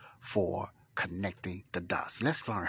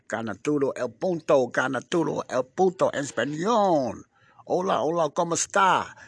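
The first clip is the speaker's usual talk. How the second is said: loud, high, lively Spanish shout; excited Spanish greeting; 5 semitones higher, 9 dB louder than usual. Acted. Host loudly greeting his Spanish listeners, excited. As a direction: hail the Spanish listeners with gusto.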